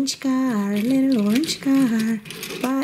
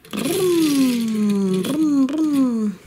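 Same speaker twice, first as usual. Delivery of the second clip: loud playful engine vroom for a toy car; 3 dB louder, much slower than usual. Pretend. Parent playing cars with child, loud vroom engine noise. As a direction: rev it up like a racing car.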